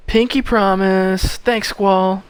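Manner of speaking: high, loud, drawn-out delight; hammed-up sweetheart voice; gushing girl character. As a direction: stretch it out high and eager. Voicing girl's happy promise.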